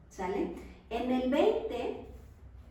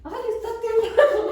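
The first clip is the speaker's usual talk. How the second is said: high, loud laughing "Ay" of fright; startled cry; 11 semitones higher, 10 dB louder, faster than usual. Retold or imitated. Preacher retelling the 2017 earthquake, startled laughing cry. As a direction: play the scare of the quake half laughing. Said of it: "y yo dije".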